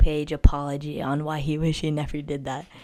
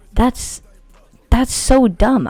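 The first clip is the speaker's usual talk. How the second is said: indignant outburst; 8 semitones higher, 10 dB louder, much slower than usual. Retold or imitated. Arguing with school staff.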